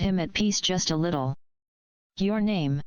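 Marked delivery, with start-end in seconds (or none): 2.2-2.8 a touch of sickly sweetness in the nurse's question; lightly sugary, falsely polite voice; sickly sweet. A narrator's reading. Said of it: "in a sickly sweet accent".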